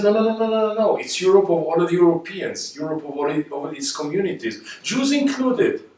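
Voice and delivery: high, insistent voice pushing back hard; impassioned no no no in Zweig's voice; Zweig's emphatic protest. Retold or imitated. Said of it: "And Zweig said".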